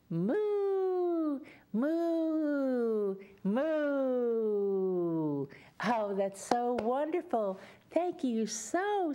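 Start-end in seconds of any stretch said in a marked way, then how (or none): 0.1-5.5 higher, fuller cow moos, falling; louder moo. Shown as a demonstration. Teacher asks children to moo louder, louder moo. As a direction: moo bigger so they hear you. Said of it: "Can you say it a little louder".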